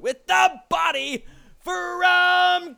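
loud, high, drawn-out introduction; wrestling ring announcer. Pretend. Host introducing co-host from Kirkcaldy, big ring announcement. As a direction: belt out the name and stretch it.

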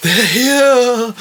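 put-on high, loud panicked cry of a pale old man; frightened old man shrieking a warning; scared old man. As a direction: yell it like a terrified villager at the window. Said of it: "old men at the window going".

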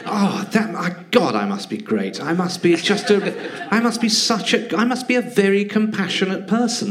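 mocking someone offended on others' behalf, hammed-up self-praise; mock self-admiration. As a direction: puff yourself up in mock awe of your own goodness. Mocks smug offended people.

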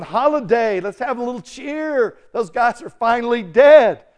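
high, cheering acted voice; celebrating world. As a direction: gloat merrily as a partying crowd. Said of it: "The whole world's going".

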